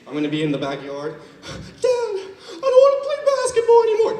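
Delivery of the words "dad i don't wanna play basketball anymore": put-on high-pitched whining child, close to crying; whiny adopted kid. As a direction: whine like a kid begging to quit basketball. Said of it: "My adopted kids".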